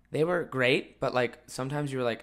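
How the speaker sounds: ordinary talk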